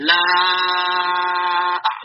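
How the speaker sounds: LA sustained long into a short "ah"; five-count elongation. Shown as a demonstration. Teacher demonstrating five harokat to students, "La" drawn out. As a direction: hold the vowel of "La" for five counts. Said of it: "lima harokat tuh".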